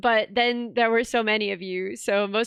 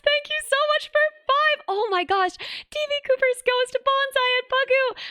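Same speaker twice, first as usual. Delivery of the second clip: excited thanks; 11 semitones higher than usual. Real, not acted. Streamer squealing thanks to a donor, very high. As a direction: squeal the donor's name with joy.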